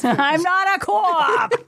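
put-on high, slow, broad Boston accent; mock Boston accent. Echoing a friend's film quote, broad mock Boston accent. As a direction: stretch "co-op" out, Boston style.

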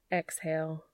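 ordinary delivery

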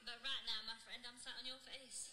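soft, firm, in-charge lover; calm her partner down, fully in control; confident older woman. Retold or imitated.

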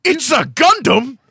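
high, loud incredulous cry; mock astonishment. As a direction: exclaim in hammed-up shock. Mock-astonished detective reacts loudly.

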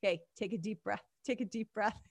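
soft, calming imitation; so chill. Host imitates chill Andre settling her down, soft and calm. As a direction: talk someone down quietly. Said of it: "he's, like, so chill".

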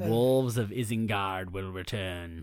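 low, slow, quiet portent; mock-ominous. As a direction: lightly intone it like an epic prophecy. Intoning a mock film line.

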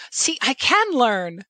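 slow, high breathy starlet voice; a slip of a Marilyn-style starlet; breathy hollywood starlet. Pretend. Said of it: "I cannot help but do an impersonation of".

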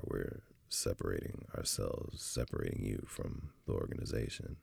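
softly, almost whispered; quiet, heavy delivery of being let go; hushed firing news. Retold or imitated. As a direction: break the bad news in a near whisper.

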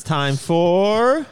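loud, slow, rising lead-in; announcer build-up. Pretend. Host cueing the updates segment, building announcer call. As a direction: stretch it out and wind up for the reveal.

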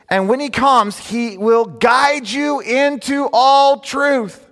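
very high, loud and drawn out slowly; the sermon's soaring climax; climactic preaching. Acted. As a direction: lift the verse to its peak. Climactic scripture over music.

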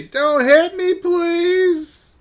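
very high, loud mock-terrified wail; hammed-up squealed plea; mock pleading. Joker begs a charging ox, high mock-panicked cry.

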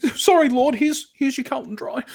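high, loud, whimpering apology through laughter; groveling servant voice. Retold or imitated. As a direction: beg forgiveness like a servant. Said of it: "It's like".

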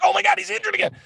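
imitating panicked fans, pitched high; high-voiced mock alarm; mock freak-out. Co-host imitating fans reacting to the injury, mock freak-out. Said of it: "everybody's freaking out".

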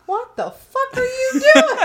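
high, slow, shouted disbelief; incredulous shout. Retold or imitated. Woken roommates staring at drunk guest, incredulous shout. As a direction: yell in stunned disbelief at the intruder. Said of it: "we just kinda look at him like".

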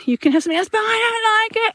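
lightly voiced whining eight-year-old; whinging child. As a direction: mimic the child complaining. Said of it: "the complaining and the whinging".

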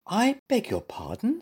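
high-pitched, polite puzzlement. A narrator's reading. Zebra answers muddled bear, high polite voice. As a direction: politely query what was just said, pitched high. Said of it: "said Stripey politely".

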